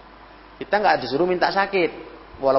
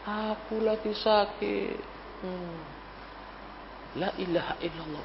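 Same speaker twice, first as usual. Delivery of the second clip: feeble, drawn-out patient's moan; sickly voice; 13 dB quieter than usual. Acted. Sick man on a phone call to a friend, weak and whiny. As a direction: play up being ill, very soft and slow. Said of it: "langsung suaranya".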